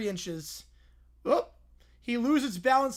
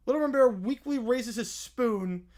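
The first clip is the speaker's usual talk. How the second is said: ordinary delivery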